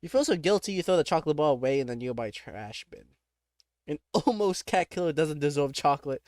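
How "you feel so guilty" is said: normal talking voice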